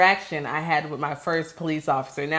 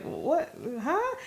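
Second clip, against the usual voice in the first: surprised; 10 semitones higher, 8 dB quieter than usual. Retold or imitated. Startled by the girl's words.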